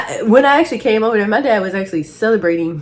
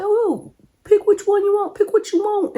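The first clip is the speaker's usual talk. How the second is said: dad's voice mimicked much higher; imitating dad; 12 semitones higher than usual. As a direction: play dad calling on the way, pitched way up. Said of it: "He was like".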